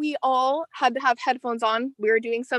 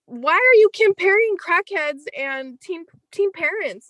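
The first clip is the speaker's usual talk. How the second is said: indignant outburst; 7 semitones higher, 5 dB louder than usual. Real, not acted. Indignant at teacher's joke.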